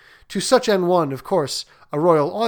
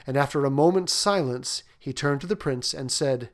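plain reading voice

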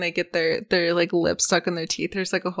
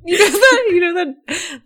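high, loud laughing while trying to talk; words squeezed out through laughter; 9 semitones higher, 6 dB louder than usual. Real, not acted. To her co-host, laughing while starting a story.

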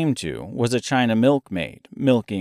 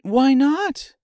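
high curious question; ask, puzzled and girlish; curious Dorothy; 4 dB louder than usual. A narrator's reading. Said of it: "Dorothy stopped and said".

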